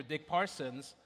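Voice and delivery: usual speaking voice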